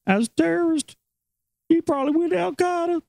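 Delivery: very high-pitched mock paranoid guy; high put-on voice of a suspicious customer. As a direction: imitate an ignorant accuser in a high voice. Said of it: "I heard some guy say that".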